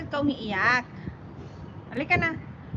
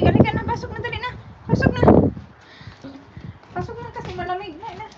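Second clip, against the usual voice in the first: loud, higher call to come in; calling inside; 6 semitones higher, 5 dB louder, much faster than usual. Real, not acted. Calling someone in out of the cold, loud and high. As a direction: urge them in, loud and high.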